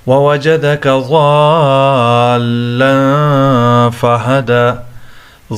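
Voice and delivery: very long held "aa" in ضالا; chanted verse with ضالا drawn far out; long madd. Shown as a demonstration. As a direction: recite slowly, stretching ضالا well beyond the other vowels. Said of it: "اس کو زیادہ کھینچنا ہوگا".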